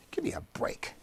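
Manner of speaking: whispered, fed up; exasperated whisper. Real, not acted. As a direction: breathe it out under your breath, disgusted. Exasperated aside.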